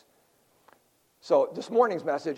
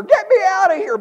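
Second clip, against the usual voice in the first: much louder and higher, a panicked cry for help; yell; 12 semitones higher, 10 dB louder than usual. A narrator's reading. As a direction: shout it across the crowded zoo. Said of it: "He yelled louder".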